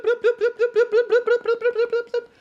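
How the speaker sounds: high, loud bloops with a laugh; loud mock bloops. Friend turns the volume up, loud laughing mock bloops. Game sound cranked up, laughing.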